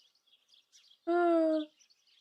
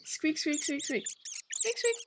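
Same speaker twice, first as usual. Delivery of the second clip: high little squeaks, softer and breathier; squeaky rubber duck; 3 semitones higher, 7 dB quieter than usual. Pretend.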